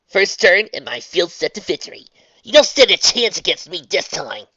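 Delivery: brash loud tomboy boasting; cocky taunt. Acted. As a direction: boast at the rival. Rainbow taunting.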